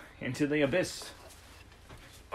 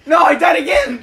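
high, loud frustrated yell; frustrated shout; 12 semitones higher, 12 dB louder than usual. Real, not acted. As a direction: cry out at dying again. Frustrated at dying again.

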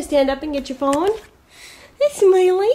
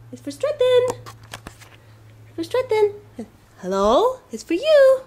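much higher sing-song teasing; playful high voice; 9 semitones higher than usual. Real, not acted. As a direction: tease the dog in a high voice. Playful phone talk to dog.

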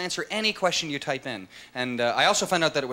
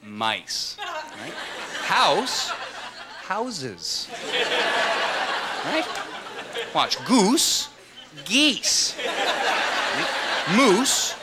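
mock teacher explaining English; much slower than usual. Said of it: "if you're trying to explain to someone how to speak English".

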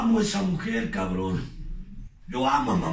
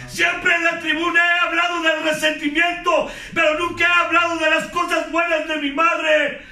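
loud, raised testimony pitched high and pushed hard; preaching at full force; 9 semitones higher, 5 dB louder than usual. Acted. Speaker confessing to his group, preaching at full force. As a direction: pour it all out from the podium.

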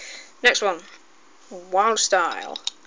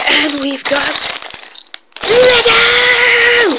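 high squealing loud reveal; losing my mind; 13 semitones higher, 8 dB louder, much slower than usual. Real, not acted. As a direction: shriek the word with delight. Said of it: "I am very excited for this".

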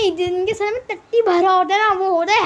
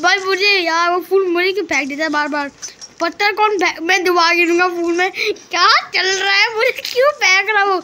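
ordinary talk

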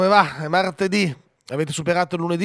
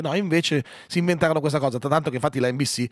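normal talking voice

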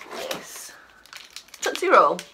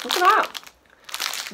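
delighted; 11 semitones higher, much slower than usual. Real, not acted. Showing off pink snowball cake.

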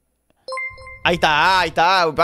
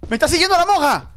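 being chased; 6 semitones higher than usual. Real, not acted.

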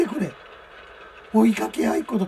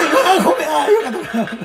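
excited laughing; 8 semitones higher, 7 dB louder than usual. Real, not acted.

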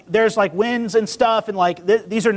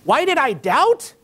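high, drawn-out disbelieving echo; slow, rising, indignant question; incredulous; 7 semitones higher, slower than usual. Pretend. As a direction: throw the question back in mock disbelief. Peter's acted incredulous comeback.

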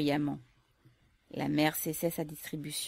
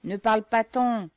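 loud, impatient bark; guard shouting at the mother to get moving; irritated shout; 5 semitones higher, 10 dB louder than usual. Acted. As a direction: snap at her to move on. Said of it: "cria le gardien avec irritation".

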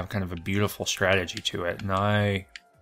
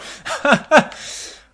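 breathy burst of real laughter; delighted laugh; 7 dB louder than usual. Real, not acted. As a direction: laugh out loud at the clever trick. Laughs at own trick.

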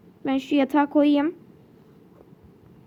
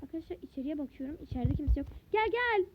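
whispering while hiding; 12 dB quieter than usual. Pretend. Sneaking into house, hiding.

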